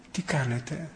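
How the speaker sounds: soft, hushed question; retold whisper. Priest softly asks a suffering patient how he is, hushed. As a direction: ask it quietly, as if at a bedside. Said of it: "του λέω ψιθυριστά".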